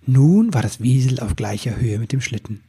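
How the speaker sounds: ordinary delivery, nothing marked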